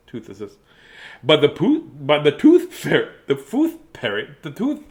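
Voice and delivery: plain reading voice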